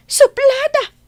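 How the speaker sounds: high, loud scornful exclamation; scornful outburst. Acted. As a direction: throw the insult out high and loud. Mother disparaging the girl Jenny.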